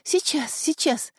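high, flustered reply; flustered bustle. A narrator's reading. Girl told to fetch the healer, flustered high reply. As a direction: answer in a high, fussing voice. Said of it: "засуетилась девушка".

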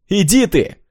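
high loud yell back; yelling across the room. Acted. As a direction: shout back through the wall. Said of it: "крикнула в ответ Наташа".

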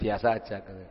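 normal talking voice

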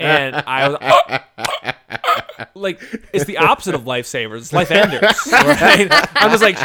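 can't keep the laugh out of the telling; laughing while telling. Real, not acted. Laughs retelling choking on candy.

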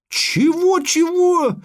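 very high, rising indignant squawk; outraged disbelief. A narrator's reading. Dwarf bickering with the girl, outraged. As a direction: shoot the pitch right up, incredulous.

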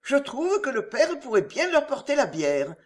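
ordinary delivery

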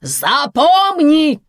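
high, drawn-out warning yell; shouting. A narrator's reading. Karina warns her husband, shouting. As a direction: shout it as an order, stretching it. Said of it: "кричит Карина".

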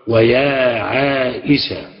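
"ويا عائشة" drawn out slowly with the final ة fully sounded; full name said slowly. Shown as a demonstration. Teacher slowly contrasting "ويا عائشة" with the clipped form. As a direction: stretch out "ويا عائشة" deliberately, every syllable clear. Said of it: "أقول".

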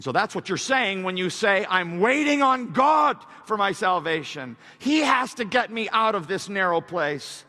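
loud, high declaration peaking in a shout; full-force preaching. Acted. Preacher voicing believer waiting on God, full-force preaching. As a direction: proclaim it with full conviction.

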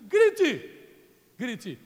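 slow loud call to shout; command to shout. Retold or imitated. Priests ordering the people at Jericho, slow loud command. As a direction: draw out the command like a battle order. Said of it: "E diz assim".

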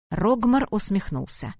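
plain reading voice

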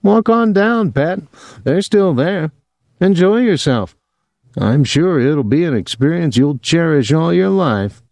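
high-pitched mock encouragement; sarcastic taunting. A narrator's reading. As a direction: goad her, all false good wishes. Man taunting her to go.

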